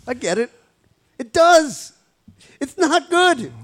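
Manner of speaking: high, timid agreeable voice; meek pushover voice. Pretend. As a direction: cave in, high and mousy.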